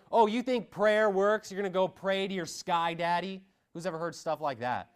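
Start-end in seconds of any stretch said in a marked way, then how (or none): 0.1-3.4 mocking sneer. Preacher mimics atheists mocking prayer, high sneering voice. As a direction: sneer at believers in mockery.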